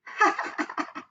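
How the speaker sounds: airy mocking ha ha ha; mock laughter. Terrence mocking Calvin, airy laughing. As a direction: laugh at Calvin like a teasing kid. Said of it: "laughed Terrence".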